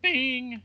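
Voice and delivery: high, ringing "bing"; imitated chime. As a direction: sing out a little game ding. Imitates a chime while playing.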